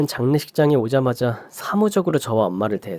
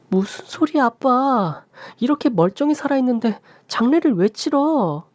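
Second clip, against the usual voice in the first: much higher, incredulous; can't believe what he asks; shocked daughter; 12 semitones higher than usual. Acted. Daughter reacting in disbelief to her father, high and shocked.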